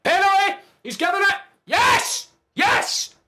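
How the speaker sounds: screaming for joy, high and loud; ecstatic yell. Real, not acted. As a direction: roar with delight. Penalty awarded.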